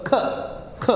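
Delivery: "k" as a short, separate kuh; bare k sound. Shown as a demonstration. Teacher drilling learners on the sound, k as kuh. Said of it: "When I say the sound".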